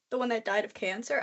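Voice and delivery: ordinary delivery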